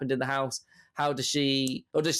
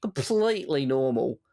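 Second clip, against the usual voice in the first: put-on Australian accent; 5 semitones higher than usual. Continues Australian bit.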